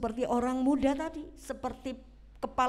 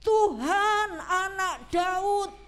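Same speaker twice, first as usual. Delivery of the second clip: crying out; 6 semitones higher, 4 dB louder, much slower than usual. Acted. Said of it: "dia teriak".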